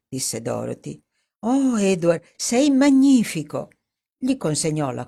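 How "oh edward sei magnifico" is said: delighted praise. Acted. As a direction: gush over him with delight. Dorothy delighted, praising Edward.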